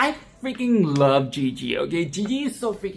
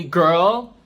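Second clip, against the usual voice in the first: put-on "Girl" hit louder, rising at the end; stress on girl; 5 dB louder than usual. Shown as a demonstration. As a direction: punch the word "Girl". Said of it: "emphasis on the girl".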